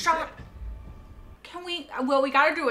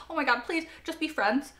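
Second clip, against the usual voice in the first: usual speaking voice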